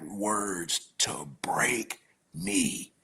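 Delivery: slow hushed whisper. Real, not acted. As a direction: breathe it out, barely voiced. Hushed about being broken.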